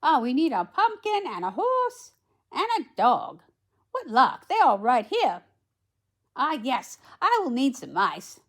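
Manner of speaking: high, loud, busy storybook fairy; lively high fairy godmother. A narrator's reading. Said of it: "said the fairy godmother".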